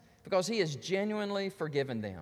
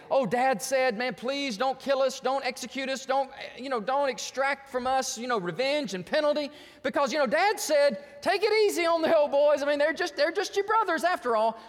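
higher-pitched, excuse-making plea; mock pleading; 5 semitones higher, 3 dB louder than usual. Brothers making up Dad's words to escape punishment, mock pleading. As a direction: plead for mercy in a hammed-up way, pitch climbing.

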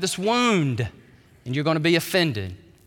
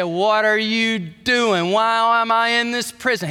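mock angry John; 6 semitones higher, 4 dB louder than usual. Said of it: "He was mad".

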